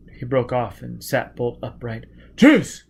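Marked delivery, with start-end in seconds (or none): 2.4-2.8 sudden, loud, higher cry; startled exclamation. A narrator's reading. Foyle realizing something mid-sentence, shouting in surprise. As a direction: jolt upright with a yelp. Foyle sits bolt upright.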